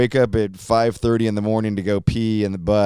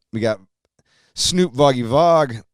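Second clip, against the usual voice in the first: ordinary talk, nothing marked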